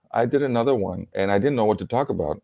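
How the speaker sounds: normal talking voice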